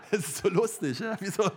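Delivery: normal talking voice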